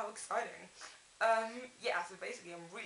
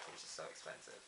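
whispered; 3 semitones lower, 11 dB quieter than usual. Real, not acted. Whispered aside on expense.

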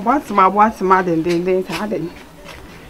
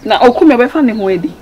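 louder, emphatic objection; heated outburst; 2 semitones higher, 9 dB louder than usual. Acted. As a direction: say it loudly, pressing the point. Heated exchange in the drama.

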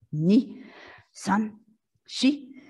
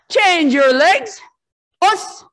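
slow, high, loud drill call; loud command; 7 semitones higher, 8 dB louder, slower than usual. Real, not acted. Instructor to kids stretching, a loud slow command. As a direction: call it out across the dojo.

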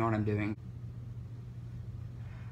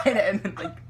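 usual speaking voice

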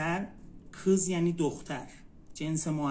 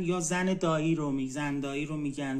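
usual speaking voice

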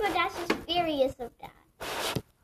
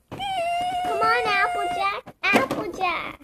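pleading cry; 5 dB louder, slower than usual. Pretend. Toy pleading with friend.

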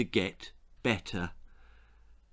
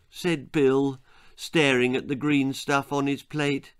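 plain reading voice

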